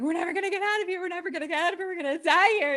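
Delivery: high, loud panicked wail; panicked wife. Retold or imitated. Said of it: "She's like".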